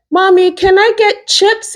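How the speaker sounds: put-on high, slow little kid voice asking for chips; child asking. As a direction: play the small child begging mommy for a snack. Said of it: "One will call me and be like".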